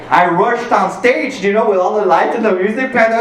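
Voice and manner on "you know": ordinary delivery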